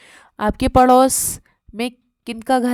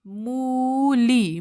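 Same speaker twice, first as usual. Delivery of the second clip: मूली stretched very slowly, each vowel held long; drawn out; much slower than usual. Shown as a demonstration. Teacher sounding out the word for the child to write, drawn out.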